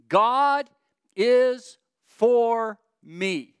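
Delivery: loud, slow, drawn-out declaration; emphatic proclamation. Real, not acted. Preacher to congregation, loud drawn-out proclamation. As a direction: proclaim it, stretching each word.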